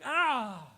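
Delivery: high, sharp yelp of pain; mock cry of pain. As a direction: yelp as if hit from behind. His cry when tackled.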